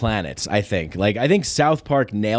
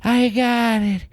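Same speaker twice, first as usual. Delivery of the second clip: higher, animated comedian delivery; Sebastian Maniscalco; 8 semitones higher than usual. Retold or imitated. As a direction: pitch it like a hyped stand-up. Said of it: "Sebastian Maniscalco".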